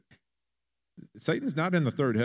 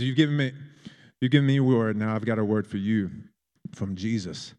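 put-on English accent; 5 dB louder than usual. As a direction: deliver the warning in a firm English accent. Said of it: "I've fallen into an English accent".

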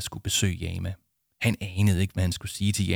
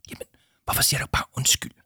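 quick forceful whisper; hushed, hurried query; 6 semitones higher, faster than usual. Acted. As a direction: whisper the question back. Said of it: "hviskede Arthur".

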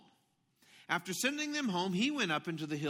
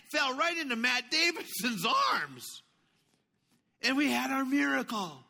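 higher, louder, thrilled; excited shout; 8 semitones higher, 5 dB louder than usual. Real, not acted. Dad reliving the miracle catch with his son, excited shout. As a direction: shout the big moment like a fan. Retelling a thrilling football play.

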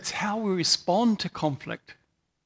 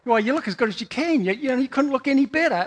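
breezy, louder, matter-of-fact man; offhand guy; 6 semitones higher, 4 dB louder, faster than usual. Retold or imitated. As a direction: toss it off like it's obvious. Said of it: "For a guy".

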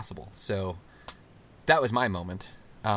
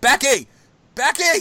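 high, loud cries of the nickname; retold yell; 12 semitones higher, 10 dB louder than usual. Captain calling his teammate after the title, high loud yell. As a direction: yell it like a captain who just won. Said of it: "he starts yelling".

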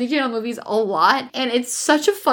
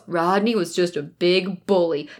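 imitated narrator; 7 semitones lower than usual. Co-host quoting the film's voiceover, as imitated narrator. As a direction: lightly put on a measured narrator voice, pitched down. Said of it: "then the narration comes in, and it goes".

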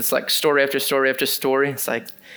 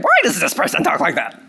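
more annoying religious spirit; faster than usual. Retold or imitated. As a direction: make the religious critic more irritating. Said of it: "Maybe it's more annoying".

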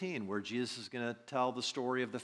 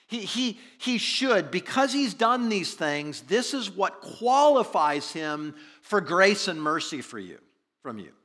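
loud, slow, insistent plea; earnest pleading; 6 semitones higher, 9 dB louder than usual. Retold or imitated. Elders telling Jesus why he qualifies, earnest pleading. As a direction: argue his case, raised and drawn out. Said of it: "they pleaded earnestly with him".

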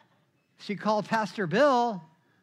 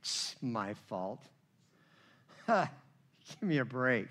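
sarcastic scoff; 8 semitones lower, 7 dB quieter than usual. Retold or imitated. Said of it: "I'm like".

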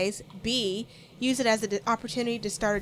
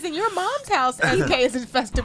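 laughing; 7 semitones higher, 6 dB louder than usual. Real, not acted. Teasing comeback while laughing.

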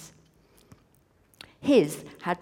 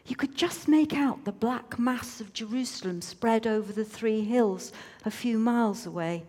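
plain reading voice

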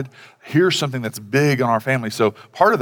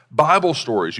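normal talking voice

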